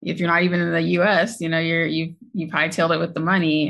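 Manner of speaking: ordinary talk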